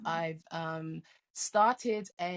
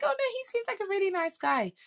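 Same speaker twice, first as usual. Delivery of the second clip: high, fast, mock girlish protest; protesting daughter; 13 semitones higher, 5 dB louder, faster than usual. Daughter defending a guy to her mom, high and quick. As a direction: brush off mom's warning in a quick high voice. Daughter dismissing mom's read.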